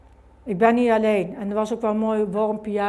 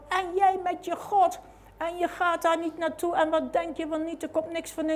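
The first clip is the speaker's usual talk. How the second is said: higher, scolding father voice; voicing angry father; 9 semitones higher than usual. Daughter imitating her father forbidding the visit, scolding high voice. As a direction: mimic the father's forbidding rant.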